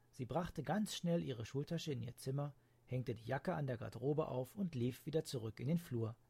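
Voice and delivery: usual speaking voice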